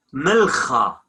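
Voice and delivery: milcha with a throaty kh; kh sound. Shown as a demonstration. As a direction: say milcha with a rasped ch as in German Bach. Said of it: "we have ch".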